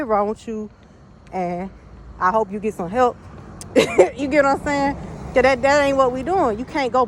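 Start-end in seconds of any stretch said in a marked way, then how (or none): 3.2-4.1 sudden high laugh, much louder; a real laugh that breaks out high; high burst of laughter. Real, not acted. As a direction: laugh out loud, high and bright. Laughing at her own joke.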